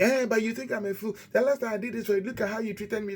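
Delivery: high, resentful complaint against the asker; wrong way, defensive. Shown as a demonstration. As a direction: dredge up last time, indignant. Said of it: "that's not how".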